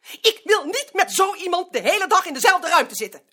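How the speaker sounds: indignant demand. Acted. Colleague demands the smoker be moved.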